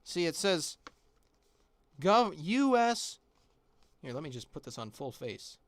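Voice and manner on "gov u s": slow emphatic reading. Real, not acted. Reading label slowly.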